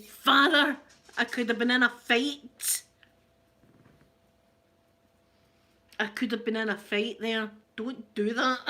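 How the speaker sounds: loud mock scolding; scolding her father. Scolding dad after he gave the finger, loud mock telling-off. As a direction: tell him off loudly, half joking. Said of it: "I said".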